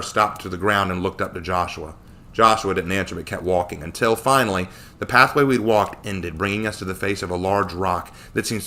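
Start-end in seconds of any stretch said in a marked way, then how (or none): none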